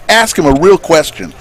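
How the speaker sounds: mild mock-annoyed bartender; upset bartender. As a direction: push back, fed up.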